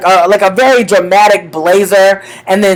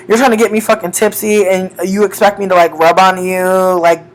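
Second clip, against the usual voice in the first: ordinary delivery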